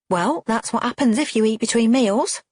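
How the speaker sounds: mother telling off her grown daughter; snapping. A narrator's reading. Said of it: "snaps Cheryl".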